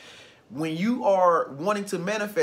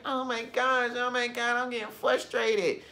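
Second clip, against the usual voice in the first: mock frustration; 5 semitones higher than usual. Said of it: "really frustrated".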